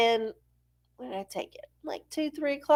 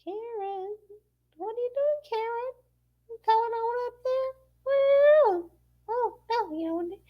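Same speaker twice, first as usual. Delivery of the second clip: higher, softer sing-song call; calling Karen; 12 semitones higher, 4 dB quieter than usual. Pretend. Calling out to the Magic Eight Ball Karen in a high voice. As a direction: coax the eight ball like a pet. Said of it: "Here's what Karen says".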